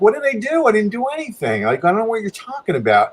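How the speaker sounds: high, baffled self-defence; protesting innocence. Retold or imitated. Storyteller answers woman blaming him, protesting innocence. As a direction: re-enact a helpless, high-pitched protest.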